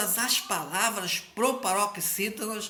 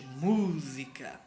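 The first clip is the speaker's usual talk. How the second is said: "Música" said very slowly, lower, with the first syllable "mú" leaned on; slow stressed word; 6 semitones lower, 3 dB quieter, much slower than usual. Shown as a demonstration. Teacher showing pupils the strongest syllable, slow and lower. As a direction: stretch out "Música" and lean on "mú". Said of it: "a antepenúltima, a mais forte".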